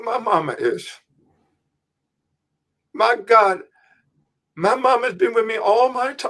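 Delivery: high tearful voice of a weeping prisoner; imitating a grown man crying with gratitude; retold crying. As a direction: pitch it up as a man overcome with tears. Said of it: "And he's crying".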